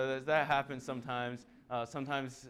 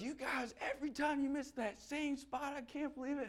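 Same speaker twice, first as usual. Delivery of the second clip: much higher, whiny, disbelieving complaint; exasperated homeowner; 13 semitones higher than usual. Retold or imitated. Homeowner complaining to the sprayer: high exasperated whine. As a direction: grumble in a put-on fussy voice.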